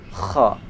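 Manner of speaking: "Kha" with a rasping scrape in the throat; rough kha. Shown as a demonstration. Teacher showing the rough sound of "Kha". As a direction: scrape "Kha" harshly at the back. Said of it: "Ada suara yang agak kasar".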